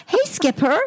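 high put-on American doll voice; American accent. Mum imitating her banned Barbie greeting, in a high American accent. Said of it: "told not to do that accent".

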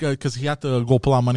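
ordinary talk

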